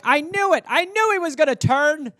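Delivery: high, loud accusing pet voice; betrayed pet. Pretend. Cat accusing owner who stepped on it, high betrayed cry. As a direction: accuse him like a wounded cat. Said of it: "the animal's like".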